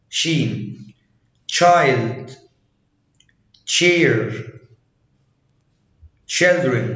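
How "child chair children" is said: put-on "child", "chair", "children" starting on a clear ch; ch sound. Shown as a demonstration. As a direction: model each opening ch in "child", "chair", "children". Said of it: "سي اتش تنطق".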